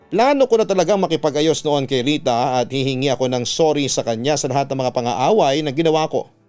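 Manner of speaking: ordinary delivery, nothing marked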